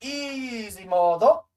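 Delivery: playful. Pretend. Playful game quip.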